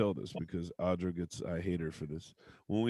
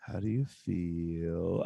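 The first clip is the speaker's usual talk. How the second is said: slow, soft, lower gushing question; touchy-feely inner child; 5 semitones lower, 5 dB quieter, much slower than usual. Pretend. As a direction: ask it slowly and softly, all feelings. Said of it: "she says".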